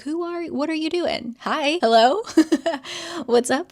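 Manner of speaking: high, nervous babbling greeting; giggly nervous hello; high, flustered mock greeting. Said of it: "Kit is trying to say something".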